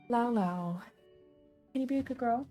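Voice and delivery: normal talking voice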